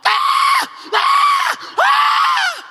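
loud, very high mock baby wail; baby's screaming reply. Newborn answering its smiling parents, screaming. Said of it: "they look at us talking about".

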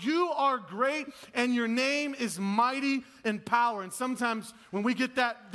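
exalt God with ringing power; full-force preaching. Acted.

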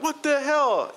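shocked. Retold or imitated. Two drunk friends at an empty lot, high shocked yelp. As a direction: throw it up high in disbelief. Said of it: "we're both like".

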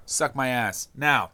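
normal talking voice

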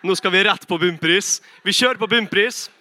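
loud, triumphant mock announcement; excited announcement. Dad announcing the trip to Bunnpris, excited. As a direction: proclaim it like a grand outing. Retelling dad's big announcement.

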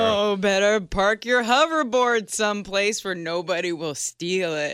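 ghostly hologram voice, slow and airy; glitchy hologram. Pretend. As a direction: speak like a flickering ghostly hologram. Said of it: "my hologram voice sounds like a ghost".

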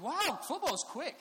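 high, loud, drawn-out wonder; wowed five-year-old, slowly and loudly; amazed little boy. Retold or imitated. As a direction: gush it like an astonished kid. Voicing his amazed little son.

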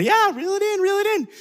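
high, eager call; excited urging. Retold or imitated. Uncle urging his niece to reel in, high and eager. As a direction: cheer her on to reel.

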